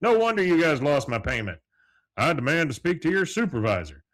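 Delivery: outraged Hank. Retold or imitated. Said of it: "he goes".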